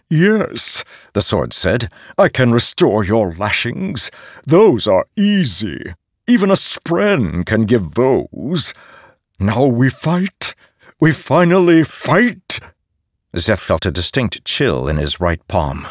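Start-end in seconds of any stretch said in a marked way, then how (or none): 0.1-0.8 a high, quick yes from the blade; the hungry sword agreeing; eager sword voice. A narrator's reading. The sword answers Szeth. 2.2-8.7 higher, slow and otherworldly blade; a sword boasting its gifts; eager sword voice. A narrator's reading. The sword answers Szeth. 9.4-12.6 high, slow, swelling to loud, now we fight rising; a blade finally let loose; thrilled sword voice. A narrator's reading. The sword eager for battle.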